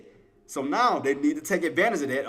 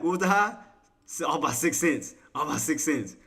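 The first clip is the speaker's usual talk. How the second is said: normal talking voice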